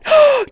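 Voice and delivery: sharp, high excited gasp. Acted. Puppet gasps at Rosie's surprise, excited gasp. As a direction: gasp with sudden delight.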